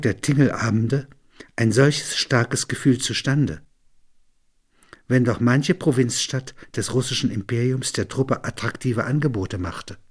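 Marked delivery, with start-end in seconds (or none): none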